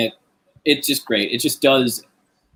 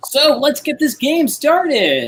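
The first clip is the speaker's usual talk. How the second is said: high, loud, drawn-out kickoff; game show host; 4 dB louder, slower than usual. Acted. Host kicking off the accent game, showy announcing.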